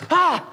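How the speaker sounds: loud high "Ah!" like throwing down a challenge; a quick playful shout to rile up the gang; short mock battle yell. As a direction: bark it out playfully. Yelled taunt to gang leader.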